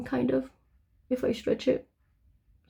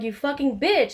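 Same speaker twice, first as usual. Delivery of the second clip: higher-pitched retold insult; raised-pitch mock outrage; retold angry outburst; 6 semitones higher, 4 dB louder than usual. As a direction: re-enact cursing someone out. Retelling her angry insult at cop.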